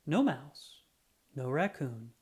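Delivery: ordinary delivery, nothing marked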